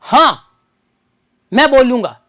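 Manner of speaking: हाँ set apart firmly, then a pause; emphatic, separated हाँ; stress on हाँ. Shown as a demonstration. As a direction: punch हाँ before finishing the sentence. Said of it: "अतिरिक्त जोर दिया मैंने".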